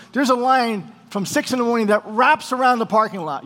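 normal talking voice

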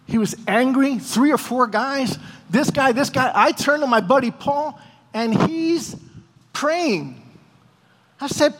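usual speaking voice

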